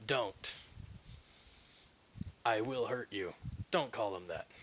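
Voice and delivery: low, restrained warning; quiet threat. Acted. Protector warning villagers who insult the child, quietly menacing.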